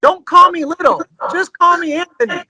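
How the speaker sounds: high-pitched imitation of a small-voiced singer; little voice. Singer correcting the new drummer, imitated little high voice. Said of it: "in a little voice".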